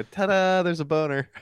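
sing-out reveal of a silly memory trick; mnemonic catchphrase. Retold or imitated. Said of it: "I used to go".

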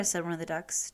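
usual speaking voice

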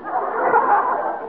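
a sudden, high burst of laughter. Real, not acted. Homer laughing off the chief's word correction.

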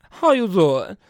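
loud catchphrase, 'doing' slurred into 'zaw'; Wendy Williams. Retold or imitated. Host imitating talk-show host, exaggerated diva catchphrase. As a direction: drop the lips on 'doing'. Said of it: "Wendy Williams".

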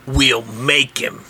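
low, slow and fierce. Acted. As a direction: growl it out slowly, low. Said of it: "[name] looks fiercely around the table".

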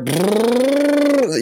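a high, buzzing lip-trill helicopter; helicopter noise. Retold or imitated. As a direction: buzz your lips like a toy helicopter.

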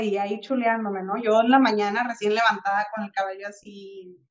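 normal talking voice

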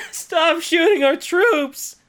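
high, loud mock shout; mock yell. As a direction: yell at your own side in mock panic. Mock yelling at allies.

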